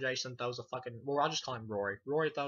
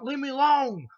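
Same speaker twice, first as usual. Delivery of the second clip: very high, loud yell, imitating; hammed-up outburst to get rid of someone; mock angry shout; 13 semitones higher, 12 dB louder than usual. Vic rebuffs the pestering kid, high mock shout. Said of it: "He's like".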